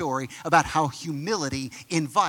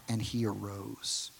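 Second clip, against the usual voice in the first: slow, much softer finish; soft reverent close; 6 semitones lower, 9 dB quieter, slower than usual. A narrator's reading. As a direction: let the miracle land gently. Soft climax of the healing.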